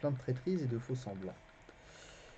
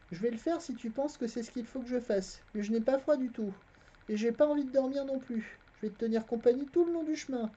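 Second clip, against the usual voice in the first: put-on a boy's voice, pitched higher; the narrator voicing the boy Garion, higher; boy lying innocently to a stranger, higher voice; young boy Garion; 13 semitones higher than usual. A narrator's reading.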